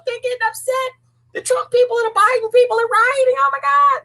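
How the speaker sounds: high squealing alarm; high mock-panicked news flash.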